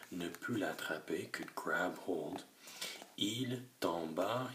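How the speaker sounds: usual speaking voice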